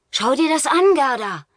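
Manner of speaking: put-on high, excited boy's cheer. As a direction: call out to her with joy. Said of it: "jubelte Kai".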